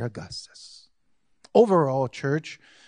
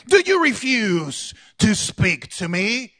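Pilate, retaken slower; 5 semitones higher, 5 dB louder, slower than usual. Acted. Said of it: "let's just go with it".

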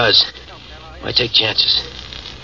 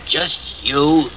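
very slow, deliberate insistence; 3 semitones higher, much slower than usual. Acted. Wounded prisoner firmly tells the boss, slowly. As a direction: drag each word out, leaving no room to argue. Steve repeats his condition firmly.

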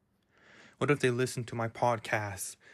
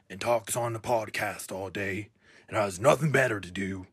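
put-on cartoon character voice; cartoon character giving a pep talk; Pete. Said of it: "I'm losing grip".

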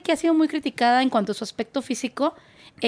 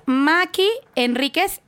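said slowly, each syllable clear; slow it down so they can write it; carefully spelled name; 4 semitones higher, much slower than usual. Shown as a demonstration. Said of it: "es con K".

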